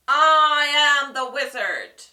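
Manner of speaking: loud, slow grand voice; little man booming as the wizard. A narrator's reading. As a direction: puff up grandly.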